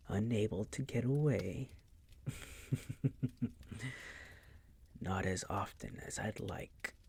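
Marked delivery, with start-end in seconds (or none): 2.1-4.6 whispered chuckle. Acted. Vampire gloats over pinned maid.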